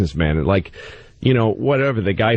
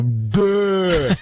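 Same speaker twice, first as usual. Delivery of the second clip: a lightly higher dopey grunt; dumb athlete voice; 7 semitones higher than usual. Retold or imitated. Host mocking a dim athlete with a dopey duh. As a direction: sound slow-witted. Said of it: "they talk and they go".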